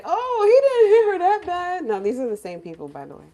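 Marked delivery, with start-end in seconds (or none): 0.0-1.8 high, loud, dismissive imitation; mock defending. In-laws defending a violent partner, mock-indignant excuse. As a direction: whine it loudly as a mocking excuse. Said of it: "You don't defend them and say".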